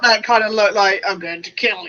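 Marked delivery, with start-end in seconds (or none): 1.1-1.9 low, softer mock threat; mock menacing. Voicing a friend's killer look, mock menacing. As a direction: drop it low like a pretend killer.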